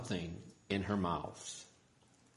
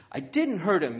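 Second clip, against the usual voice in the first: loud, higher-pitched denial; yelled protest; 7 semitones higher, 5 dB louder than usual. A narrator's reading. Isabella told off by her dad, yelling back. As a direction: protest hotly at being blamed. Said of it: "yelled Isabella".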